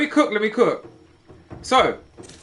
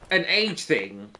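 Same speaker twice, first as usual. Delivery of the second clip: usual speaking voice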